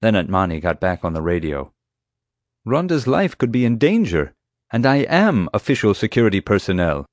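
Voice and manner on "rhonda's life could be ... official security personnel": higher-pitched, urgent protest; insistent retort. A narrator's reading. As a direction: argue your authority back over the radio. Argues back over the radio.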